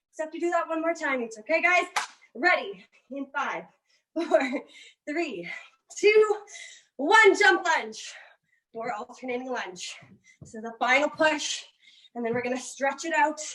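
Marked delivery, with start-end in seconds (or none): 6.0-7.6 shouted countdown. Real, not acted.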